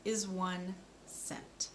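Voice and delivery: "This is one cent" said very slowly, each word apart; slow model sentence. Shown as a demonstration. Speech therapist models a sentence for a child, slow and careful. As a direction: spell it out slowly for the learner. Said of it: "One more time".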